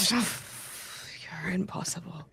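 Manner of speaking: soft, slow, breathy chuckled words; fond laughing. Acted. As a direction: whisper it through a laugh. Fond reply to partner's flirty joke.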